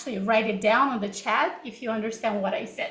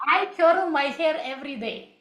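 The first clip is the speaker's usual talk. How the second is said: higher-pitched Russian-accented sentence; 4 semitones higher than usual. Shown as a demonstration. Teacher testing what chat viewers understand, Russian accent. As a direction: demonstrate English with a Russian accent. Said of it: "I was also speaking in this way".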